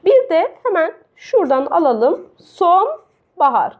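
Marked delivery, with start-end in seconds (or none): 2.4-3.8 slow example word. Shown as a demonstration. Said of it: "Son ve bahar kelimesi birleşmiş".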